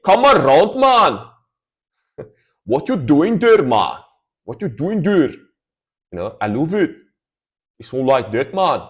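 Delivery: high, loud mock Scottish accent. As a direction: ham up a Scottish accent for fun. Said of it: "is Scottish".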